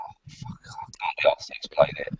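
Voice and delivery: whispered, weary and exasperated. Retold or imitated. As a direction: sigh it out under your breath, fed up. Weary inner reaction.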